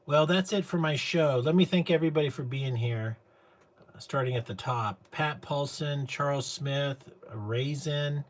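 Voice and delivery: thanking the audience like a talk show host; show host sign-off. Acted. Host closing his show to his audience, big announcer sign-off.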